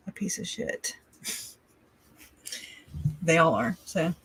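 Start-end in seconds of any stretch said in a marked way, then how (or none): 0.1-1.0 hushed, breathy curse under the breath; real whisper; whispered insult. Real, not acted. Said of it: "whispered insult".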